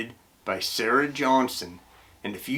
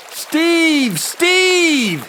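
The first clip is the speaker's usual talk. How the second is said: high, loud, desperate calls; screaming; 6 dB louder than usual. A narrator's reading. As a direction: scream your brother's name for help. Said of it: "he screamed".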